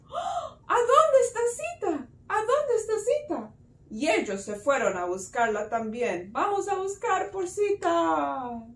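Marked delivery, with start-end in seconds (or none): 0.1-3.5 high-pitched, gasping, worried question; gasp, then call out in mock panic; acted alarm. Brothers find the hut empty, alarmed. 6.3-8.7 high, drawn-out storybook call; rallying call. Acted. Brothers set off to search for Sita, calling out.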